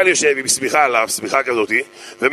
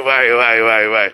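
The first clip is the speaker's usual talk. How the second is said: mock lament; 4 semitones lower than usual. Mock lament over the flop.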